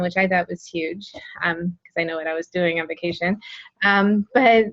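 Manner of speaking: normal talking voice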